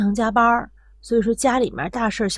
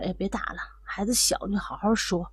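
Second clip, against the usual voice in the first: gentle pleading; 2 semitones lower, 7 dB quieter than usual. Retold or imitated. Grandmother steps in to stop the hitting, softly pleading. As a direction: quietly act out talking someone down. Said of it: "就上去就劝说".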